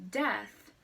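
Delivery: ordinary delivery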